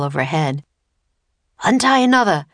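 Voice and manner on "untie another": loud, high command; raised, pressing voice; urgent shout. Acted. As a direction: shout the order to hurry them on. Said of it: "shouted Teddy".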